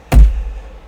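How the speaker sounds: loud exhaled sigh; heavy sigh. Acted. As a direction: let out a weary breath. Captain troubled about Simon.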